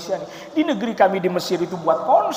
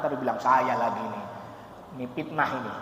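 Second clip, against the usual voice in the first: ordinary talk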